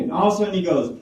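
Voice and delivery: usual speaking voice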